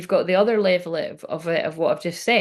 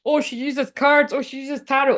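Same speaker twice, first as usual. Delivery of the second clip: mocking; 6 semitones higher than usual. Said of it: "it'd be like, if they find out".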